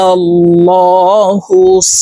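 slow, drawn-out chant; slow recitation. Shown as a demonstration. Teacher recites the joined word slowly. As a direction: recite it stretched out. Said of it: "হা সদ পেশ হুস".

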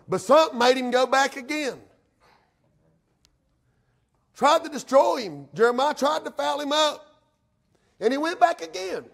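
high, driving pulpit delivery; fervent preaching. Real, not acted. Preacher tells church of Samson going back, fervent preaching.

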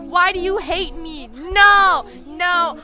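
shouting over the sounds. Real, not acted. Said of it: "I'm just gonna scream over it".

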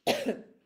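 sudden high, loud cough mid-sentence; lingering cold cough breaking in; real cough. Real, not acted. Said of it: "una tos que no se me pasa".

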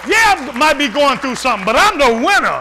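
loud shouted preaching, voice up high; full-force preaching. Acted. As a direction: shout it out to the congregation.

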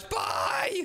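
very high shrieked bye; squealed goodbye. Real, not acted. Fleeing her seeker brother, very high shrieked bye. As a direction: shriek it as you run off.

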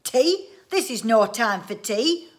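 loud, high outraged exclamation; indignant roar. A narrator's reading. Inventor answers wife's offer of tea, roaring. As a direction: roar it in disbelief. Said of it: "roared Mister Winsborough".

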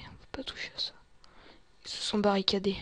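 usual speaking voice

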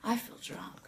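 soft, dazed near-whisper; groggy coming-to. Retold or imitated. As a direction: barely voice it, as if still woozy. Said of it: "she comes to".

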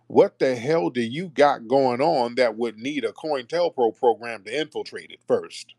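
usual speaking voice

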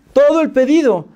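high-pitched indignant outburst; outraged. Retold or imitated. Customer shocked by the delivered order, indignant.

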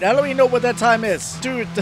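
usual speaking voice

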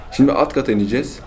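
ordinary talk

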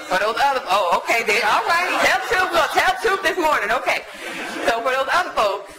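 high, loud laughing call to the room. Real, not acted. Teasing the audience that answers back, laughing loudly. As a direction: laugh along and egg the room on.

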